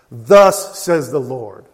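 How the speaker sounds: very loud, slow and emphatic; loud proclamation. Acted. Preacher declares his sermon title, loud proclamation. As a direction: ring it out as a solemn decree.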